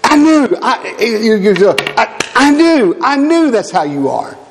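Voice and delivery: high, whiny imitation of a spouse arguing; mock the nagging partner; hammed-up spouse in a quarrel.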